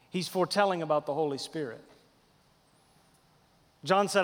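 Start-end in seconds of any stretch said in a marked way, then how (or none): none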